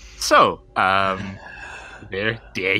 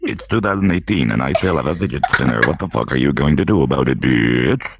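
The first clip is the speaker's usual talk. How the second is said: lower, monotone robot voice; 7 semitones lower, 4 dB louder, faster than usual. Shown as a demonstration. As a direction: recite it like a deadpan robot. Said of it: "say that in a robot voice".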